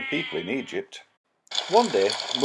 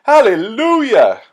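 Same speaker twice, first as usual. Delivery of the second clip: joyful hallelujah; 7 semitones higher, 12 dB louder than usual. Acted. Storyteller cheering the healing for the children, high and loud. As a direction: belt out Hallelujah with joy. Celebrating the healed hand.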